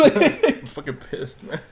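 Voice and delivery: slow, laughing fake fury; laughing mock anger. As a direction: drag it out laughing.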